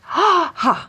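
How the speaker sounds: repeated quick gasps, high in and out; rhythmic, audible open-mouthed breaths; loud breathing. Shown as a demonstration. Teacher shows how the scrambling exercise goes, loud breathing. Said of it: "So it goes like this".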